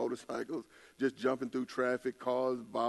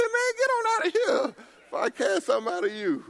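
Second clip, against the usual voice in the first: high laughing shout; 7 dB louder than usual. Retold or imitated. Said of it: "I said".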